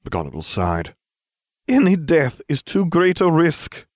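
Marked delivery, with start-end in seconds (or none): 1.7-3.9 much higher, louder prim teacher voice; strict old teacher, louder and much higher; stern headmistress. A narrator's reading. McGonagall voiced in story.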